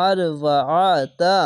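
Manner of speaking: ordinary talk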